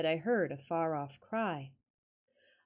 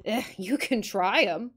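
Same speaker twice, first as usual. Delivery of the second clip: put-on grumbling Yorkshire old man, a touch louder; reluctant Yorkshire mutter; 2 semitones higher, 6 dB louder than usual. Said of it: "muttered my reluctant guide".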